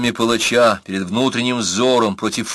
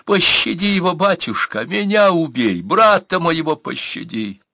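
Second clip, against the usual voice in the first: high-pitched desperate begging; wailing plea; 9 semitones higher, 3 dB louder than usual. A narrator's reading. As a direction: wail it like a woman begging for mercy. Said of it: "взвыла она".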